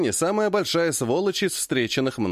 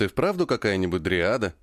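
ordinary delivery

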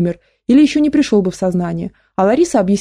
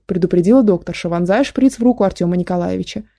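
usual speaking voice

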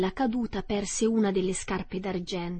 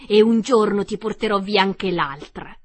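gloating taunt; 9 dB louder than usual. Acted. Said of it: "disse la strega ridendo".

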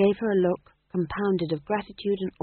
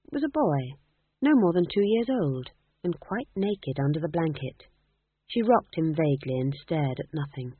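usual speaking voice

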